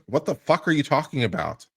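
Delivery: ordinary delivery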